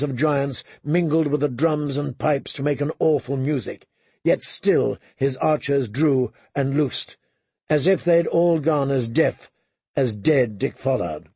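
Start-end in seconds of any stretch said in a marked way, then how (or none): none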